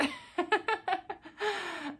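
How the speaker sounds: breathy high giggle; high laugh. Real, not acted. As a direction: laugh at the memory. Laughing at mom's shock.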